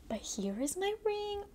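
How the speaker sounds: hushed squeaky reveal of the prize; excited reveal. Real, not acted. Unveiling the ring from bath bomb.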